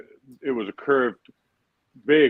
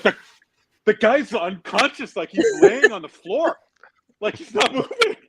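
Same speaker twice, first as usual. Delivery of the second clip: incredulous protest; 10 semitones higher, 7 dB louder than usual. Retold or imitated. Player telling the dealer the guy is unconscious, high and loud. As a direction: plead that the man is out cold.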